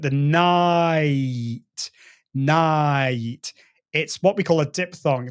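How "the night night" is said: "night" stretched very slowly with its vowel drawn out; elongated night. Shown as a demonstration. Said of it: "if we slow down".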